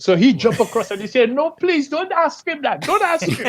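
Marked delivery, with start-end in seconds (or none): 1.3-3.4 high, urgent plea to the officer, half-joking; pleading friend. Retold or imitated. Friend cutting in to stop the officer, mock pleading. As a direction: jump in and beg, pitched well up.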